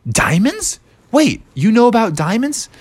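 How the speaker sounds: high, rising astonished question; surprised interest. Acted. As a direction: perk up in disbelief. Dad amazed by diamond mention.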